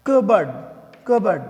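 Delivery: "cupboard" as kuh-bud, loud; "cupboard" short and stressed, p silent; cupboard said right. Shown as a demonstration. Teacher insisting to students on the right way to say cupboard.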